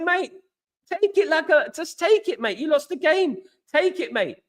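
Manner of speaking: high-pitched retold urging; retold exasperated plea. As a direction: tell a sore loser to accept it, voice pitched right up. Retells goading the losing fan.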